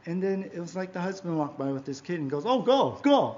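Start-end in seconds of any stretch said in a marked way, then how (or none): 2.5-3.3 imitated sneer, high-pitched; mocking. Husband walking by with his kid at the store, mocking. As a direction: mimic a passerby's mocking urging. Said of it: "like mocking me".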